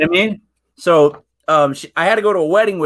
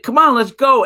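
higher, louder mock nagging; impatient wife; 7 semitones higher, 6 dB louder than usual. Wife urging him to leave the game for the wedding, mock-impatient. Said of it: "And she's like".

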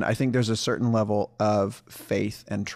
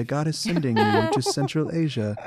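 higher mock British angel voice; British-accented angel; 5 semitones higher than usual. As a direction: announce it in a put-on British accent, pitched up. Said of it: "would have a British accent".

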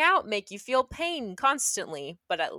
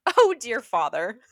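breaking into laughter; 7 dB louder, slower than usual. Acted. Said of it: "This isn't that funny".

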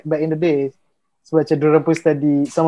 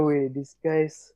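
hushed awestruck murmur; 9 dB quieter than usual. Retold or imitated. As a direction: mutter it under your breath in awe. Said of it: "Aku macam".

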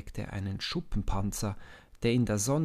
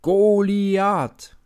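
drawn-out chant, much louder and higher; shouting crowd; 11 semitones higher, 15 dB louder than usual. A narrator's reading. Philistine army chanting their champion's name, loud and high. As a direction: bellow the name like a whole army. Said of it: "schreien die Philister".

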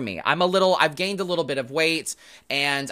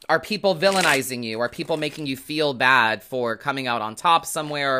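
normal talking voice

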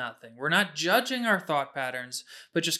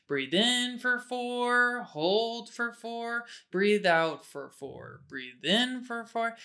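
very slow, higher guided counting; slow breath counting; 6 semitones higher, much slower than usual. Shown as a demonstration. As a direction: draw out each word like a breathing coach. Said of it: "I kinda do this motion".

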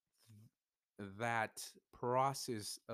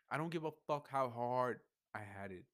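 louder and higher, still fired up; emotional outburst; 4 semitones higher, 6 dB louder than usual. Real, not acted. Venting to viewers, still worked up and louder. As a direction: hammer it home with real feeling. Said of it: "I get emotional about this shit".

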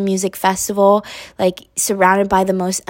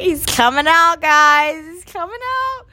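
excited squeal; 9 semitones higher, 4 dB louder than usual. Real, not acted. Announcing someone emerging, excited.

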